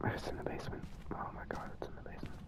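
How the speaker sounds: barely audible, dismayed whisper; frightened whisper. Real, not acted. Player learns the ghost is in the basement, frightened whisper. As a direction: whisper it under your breath in dread.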